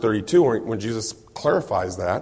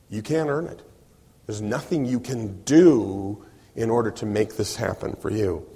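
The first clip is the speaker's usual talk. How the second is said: ordinary talk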